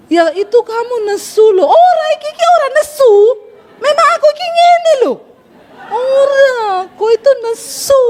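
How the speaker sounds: high-pitched, exasperated squabble; mock angry bickering. Husband and wife arguing over who is angry, comically heated. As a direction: protest indignantly, voice shooting up high. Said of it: "Akhirnya yang tadinya nggak marah jadi marah".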